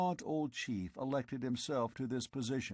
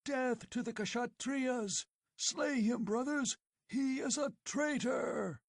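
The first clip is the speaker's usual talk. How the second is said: shrill high shouting war cry; rally the mob to kill; 14 semitones higher than usual. Acted. Tribesmen crying for Conan's death, savage.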